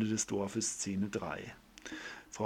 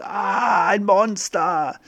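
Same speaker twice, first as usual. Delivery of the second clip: high, loud shriek, then slowly drawing out the last words; terrified scream; 9 semitones higher, 9 dB louder, much slower than usual. Acted. Neighbour runs out of his house screaming in terror. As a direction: scream in horror at the monster.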